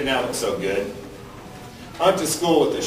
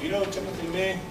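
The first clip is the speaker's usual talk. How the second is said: much softer musing to himself, almost under his breath; inner voice; 10 dB quieter than usual. Retold or imitated. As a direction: talk yourself into the idea quietly. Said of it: "I got thinking to myself".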